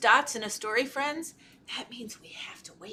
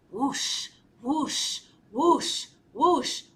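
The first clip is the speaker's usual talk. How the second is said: repeated wingbeat whooshes; whoosh sounds; much slower than usual. A narrator's reading. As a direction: build a row of rising whooshes. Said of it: "He heard the sound again".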